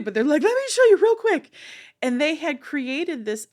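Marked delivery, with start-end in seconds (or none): 0.4-1.5 put-on high, fast, loud childlike pleading; eager children. The inner children urging her to look, high and eager. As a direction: kids tugging you along excitedly.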